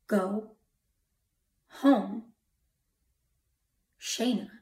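word by word, long pauses between; measured, weighty command from an unseen voice; solemn commanding voice. Retold or imitated. Unseen voice calling the stranded driver, solemn command. The same voice heard again.

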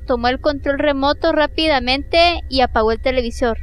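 normal talking voice

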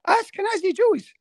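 very high-pitched comic disbelief; incredulous repeat. Real, not acted. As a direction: squeak it out, astonished.

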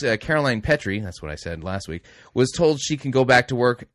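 normal talking voice

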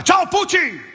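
high, slow hammed-up Veneto greeting; mock Veneto big man. Big man from Rovigo greets the comedian, high slow mock Veneto voice. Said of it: "Mi dà la mano e fa".

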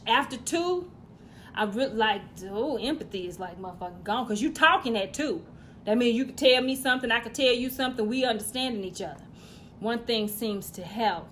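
normal talking voice

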